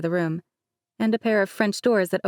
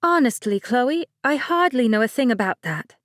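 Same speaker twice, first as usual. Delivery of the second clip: light higher-pitched bride, laughing it off; Mercedes voice; 6 semitones higher, 4 dB louder than usual. Acted. Bride Mercedes teasing her cousin, higher light voice. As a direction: play the bride breezily.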